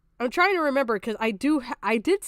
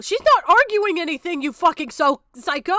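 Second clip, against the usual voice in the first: yelling, much higher and louder; furious shout; 6 semitones higher, 9 dB louder than usual. Real, not acted. Streamer yelling at the video's commentator, furious shout. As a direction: blow up at the commentator in a rage.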